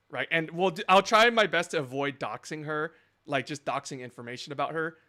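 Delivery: ordinary delivery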